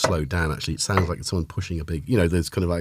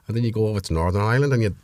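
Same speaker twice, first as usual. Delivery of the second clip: Northern Irish accent attempt; much faster than usual. Shown as a demonstration. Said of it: "wasn't as seamless as I wanted".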